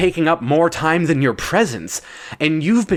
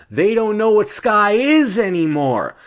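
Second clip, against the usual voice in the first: usual speaking voice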